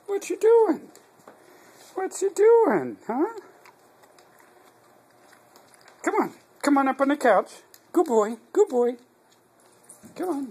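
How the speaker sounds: excited pet talk. Acted. Coaxing dog onto couch.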